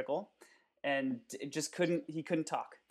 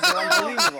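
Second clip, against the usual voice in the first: laughing; 7 semitones higher, 13 dB louder, faster than usual. Real, not acted. Laughing fit.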